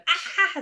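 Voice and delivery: high, loud imitated screaming; short childish screaming burst; mock tantrum scream. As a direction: act out a screaming fit. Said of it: "screaming again".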